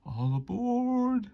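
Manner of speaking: high, slow, loud all-aboard call; conductor's call. Acted. Conductor calling passengers aboard, high and drawn out.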